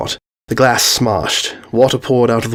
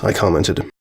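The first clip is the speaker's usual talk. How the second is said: plain reading voice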